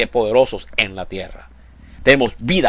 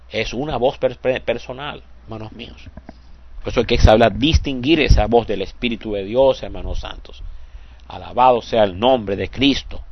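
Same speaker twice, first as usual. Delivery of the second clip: usual speaking voice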